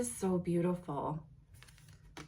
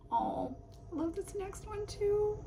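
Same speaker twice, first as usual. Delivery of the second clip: much higher, gushing delight; delighted squeal; 11 semitones higher, 4 dB quieter than usual. Real, not acted. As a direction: squeal with joy at the card. Delighted by next card.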